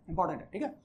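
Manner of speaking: softly echoed important है; quiet repeat. Shown as a demonstration. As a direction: drop the voice and repeat important है to let it sink in.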